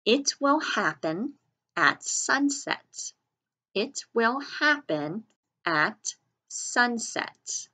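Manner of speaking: measured teacher dictation with pauses between words; dictating a sentence. Shown as a demonstration. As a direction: dictate it word by word with careful articulation. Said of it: "So try that sentence".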